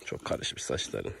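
slow, soft whisper to the horse; Çok karışmış saçların whispered slowly. Real, not acted. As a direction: murmur tenderly while combing. Combing the mane gently.

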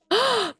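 quick, high gasp; surprised gasp. Real, not acted. Player seeing Bria's milestone, surprised gasp. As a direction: gasp with sudden delight.

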